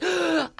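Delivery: sharp, high intake of breath; excited gasp. Retold or imitated. Rugby player recalling her big chance at Murrayfield, excited gasp. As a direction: re-enact a gasp of sheer excitement. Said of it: "I was humbled. I was like".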